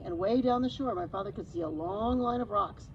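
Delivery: plain reading voice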